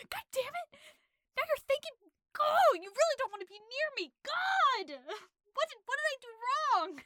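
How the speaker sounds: high, loud exasperated ranting; frantic rant. Retold or imitated. Master ranting at the maid's thanks, high and loud. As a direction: throw a hammed-up tantrum. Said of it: "he's like".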